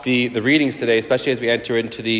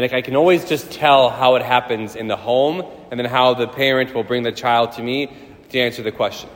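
usual speaking voice

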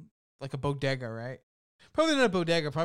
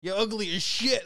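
higher, louder retold insult; mock roasting; 6 semitones higher, 6 dB louder than usual. Film hero insulting an old lady, amused mock roast. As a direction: hurl it as a comic roast. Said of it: "like, roasting this, like, lady".

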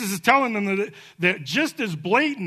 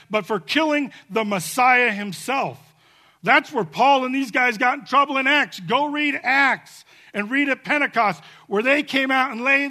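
ordinary talk

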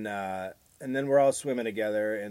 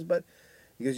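ordinary talk, nothing marked

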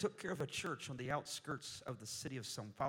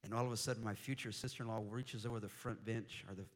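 ordinary talk

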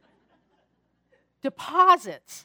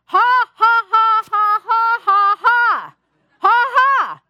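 loud, higher, deliberate ha ha ha laughter; forced laugh; 8 semitones higher, 11 dB louder, much slower than usual. Shown as a demonstration. Laughing out loud at the neighbor's barking dogs, forced and loud. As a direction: belt out a put-on laugh, one ha at a time. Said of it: "I started laughing".

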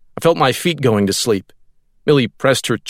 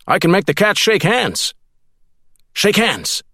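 much higher, eager command to a pet; bossy and delighted, talking to the cat; Burnett coaxing cat; 10 semitones higher than usual. A narrator's reading.

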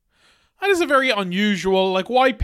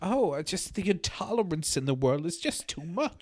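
much softer comedian impression; quiet mock lament; Bernard Manning; 11 dB quieter than usual. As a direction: lament it softly in character. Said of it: "Bernard Manning's like".

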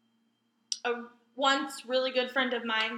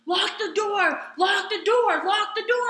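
retold yell; 6 semitones higher, 4 dB louder, slower than usual. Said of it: "fucking yelling".

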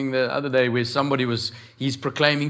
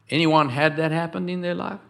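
ordinary delivery

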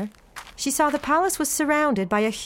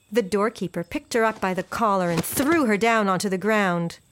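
ordinary delivery, nothing marked

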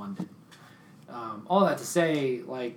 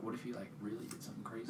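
hushed; 14 dB quieter, faster than usual. Real, not acted. Dark speculation.

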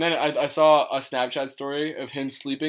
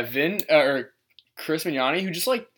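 usual speaking voice

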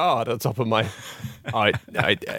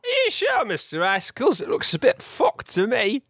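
hammy captain; 12 semitones higher than usual. Acted. Captain character speaking.

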